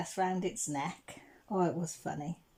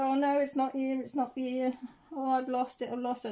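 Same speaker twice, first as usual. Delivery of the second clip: higher and louder, a panicky mock lament; retold distressed wail; 6 semitones higher, 5 dB louder than usual. Daughter at the riverbank wailing that her necklace is gone, panicky and loud. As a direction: fret out loud like a kid who lost her treasure.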